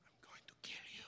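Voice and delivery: low menacing whisper, barely voiced; threatening whisper. Mom after the garage crash, threatening whisper. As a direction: hiss the threat under your breath. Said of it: "She goes".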